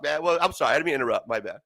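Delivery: normal talking voice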